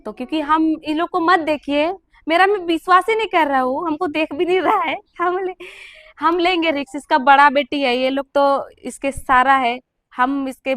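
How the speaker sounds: ऊँची और ज़ोरदार आवाज़ में जल्दबाज़ी से कहना; घबराहट भरी ज़िद, ऊँचे सुर में; urgent pleading. Real, not acted. Pleading urgently, taking the risk. (पिता के लिए रिस्क लेने की ज़िद, ऊँची आवाज़ में)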